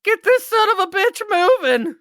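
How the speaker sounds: high, loud, hysterical shriek. Retold or imitated. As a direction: shriek it in a panic. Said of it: "That's a good hysterical shriek".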